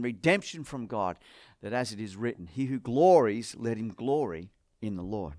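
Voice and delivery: solemn reading of Corinthians to the congregation; scripture reading. Acted. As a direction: read the passage with weight. Reads scripture aloud.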